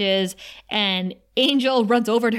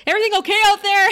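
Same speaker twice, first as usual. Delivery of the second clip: high, quick, loud mock call; 12 semitones higher, 6 dB louder, much faster than usual. Man in the shower checking on his guest, playful high call. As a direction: call it brightly to someone in the next room. Acted Angel calling from bathroom.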